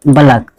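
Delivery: "बलक" as two syllables, ba-LAK; "बलक" ending in -lak, not -laik; taught pronunciation "बलक". Shown as a demonstration. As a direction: say "बलक" with a vowel after the b. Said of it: "बलक बोलेंगे".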